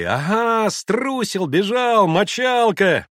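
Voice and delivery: high, loud jeering; clamouring boys. A narrator's reading. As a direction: taunt the runaway like kids. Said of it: "В группе загалдели". (громкая мальчишеская дразнилка)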